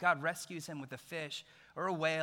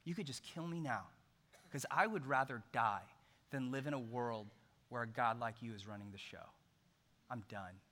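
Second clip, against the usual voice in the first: bitter resignation; 5 semitones lower, 6 dB quieter, faster than usual. Retold or imitated. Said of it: "He says".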